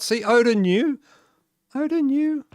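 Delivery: very high, delighted singsong; cooing praise. Real, not acted. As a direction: praise a pet in a high little voice. Praising companion that found crystals.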